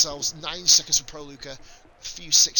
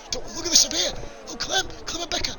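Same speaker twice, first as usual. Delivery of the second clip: much higher, calling out the clash; live commentator spotting a crash, excited shout; 13 semitones higher than usual. Real, not acted. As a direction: yell it as the cars collide. Sudden on-track clash spotted.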